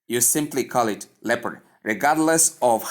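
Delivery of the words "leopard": "leopard" as two syllables, short e first, silent O; pronunciation of leopard. Shown as a demonstration. Teacher showing learners how to pronounce it, "leopard" as LEP-erd. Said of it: "when you want to pronounce the word".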